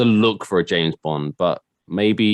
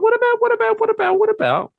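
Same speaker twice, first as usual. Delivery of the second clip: very high, squeaky nagging voice; squeaky mock pestering; squeaky repeat. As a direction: pipe up in a silly squeak. High-pitched teasing repeat.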